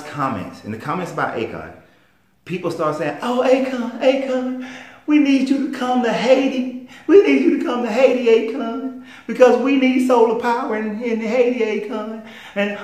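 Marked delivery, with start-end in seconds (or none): 3.2-12.2 high-pitched whining plea, dragging out the name; mock a helpless fan begging in a high, loud whine. Followers begging [name] to come to Haiti, mocking high whine. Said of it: "So don't sit whining".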